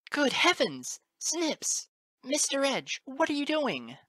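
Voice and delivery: very high, loud horrified woman; alarmed cry. A narrator's reading. Teacher seeing a colt choked, high alarmed cry.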